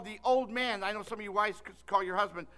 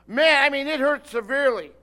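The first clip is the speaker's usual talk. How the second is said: emphatic retelling; 3 semitones higher, 13 dB louder than usual. Retold or imitated. Reliving the pain.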